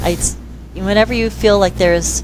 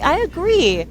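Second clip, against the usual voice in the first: parent agreeing; 10 semitones higher than usual. Retold or imitated. Parents reacting.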